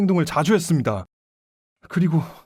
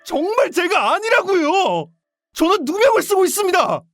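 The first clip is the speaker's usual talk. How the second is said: high, strained cry of innocence; impassioned, high-pitched denial; desperate protest; 10 semitones higher than usual. Acted. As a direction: insist desperately that you were framed. Suspect cries out he is framed.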